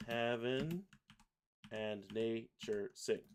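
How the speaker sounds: slow, segmented lyric; measured dictation to oneself; syllable-by-syllable reading. Shown as a demonstration. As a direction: place one syllable at a time. Reads lyrics while pasting syllables.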